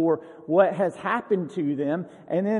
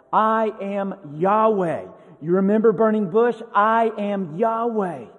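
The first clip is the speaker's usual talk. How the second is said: loud, emphatic declaration as God; God insistently reminding Moses who he is; heavy emphasis; 3 semitones higher, 5 dB louder than usual. Acted. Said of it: "he does come with a heavy emphasis".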